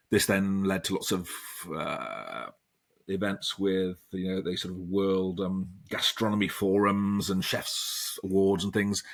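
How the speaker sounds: usual speaking voice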